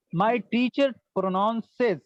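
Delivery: "My teacher pronounces" said very slowly, word by word; slow model phrase. Shown as a demonstration. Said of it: "slow model, then normal repeat". ("My teacher pronounces" बहुत धीरे, शब्द-शब्द)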